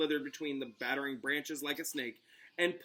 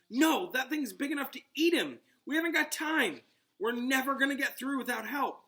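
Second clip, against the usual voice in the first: urgent; 11 semitones higher, 5 dB louder than usual. Acted. Urgent argument in danger.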